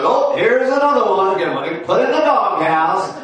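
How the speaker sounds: a hammed-up worker greeting yet another one; bored worker. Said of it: "the guy's like".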